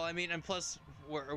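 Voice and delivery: usual speaking voice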